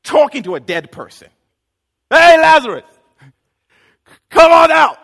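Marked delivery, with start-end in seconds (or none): 2.1-5.0 loud call. Acted. Jesus talking to a dead person, loud call. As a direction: yell it into the dark tomb. Calling into a tomb.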